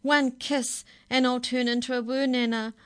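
put-on girl sobbing about her scary grandmother; tearful wail. A narrator's reading. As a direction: cry it out in fear. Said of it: "Stella Rose sobbed".

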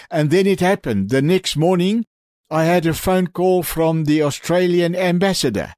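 usual speaking voice

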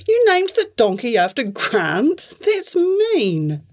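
high, indignant disbelief; a girl scandalised by a mean joke; shocked. Acted. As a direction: protest in rising outrage. T shocked at the name.

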